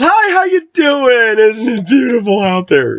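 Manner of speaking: very high, slow, syrupy greeting; imitating a pushy salesman's sugary welcome; mock salesman. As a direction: ham up a fake-friendly salesman. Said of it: "going into businesses with professionals".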